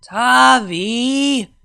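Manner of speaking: high, loud whining call of a name; annoyed whine. Acted. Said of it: "let out an annoyed whine".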